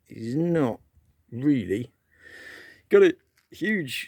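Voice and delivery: talking through hiccups, words jolted. Real, not acted. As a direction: hiccup breaking the phrase. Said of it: "excuse me, I got the hiccups".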